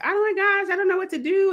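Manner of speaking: high, wailing panic, imitating a crying friend; hammed-up high distressed wail; mock crying friend. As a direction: squeal it out like a friend in tears. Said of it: "crying, like".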